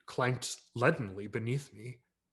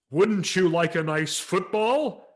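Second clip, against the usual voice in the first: loud, higher, slow coaxing Santa voice; Santa suggesting; 7 semitones higher, 8 dB louder, slower than usual. A narrator's reading. Santa nudging the tongue-tied boy, coaxing. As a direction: coax the boy toward a football.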